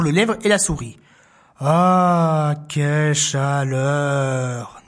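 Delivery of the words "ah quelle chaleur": low, very slow warm sigh; contented sigh. Acted. Three animals sighing in chorus, slow and low. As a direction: relish the warmth. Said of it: "disent-ils en chœur".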